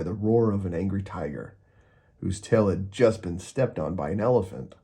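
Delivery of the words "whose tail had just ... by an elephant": usual speaking voice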